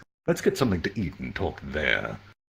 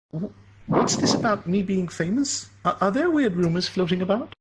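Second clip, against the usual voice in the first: put-on higher, stammering girl's voice, flustered; a shy woman caught off guard; flustered young woman; 13 semitones higher than usual. Flustered Sawatari voiced by narrator.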